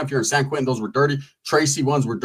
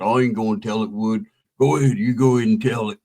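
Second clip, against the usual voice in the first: imitating his talk; 7 semitones lower than usual. Narrator imitating the homeboy passing the story to Wood. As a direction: mimic how he talked, low. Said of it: "That's how he talked".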